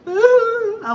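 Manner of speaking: high, loud, slow wail; crying like a baby. Retold or imitated. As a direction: draw it out in an ugly-cry imitation. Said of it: "I started crying, and it's like a baby".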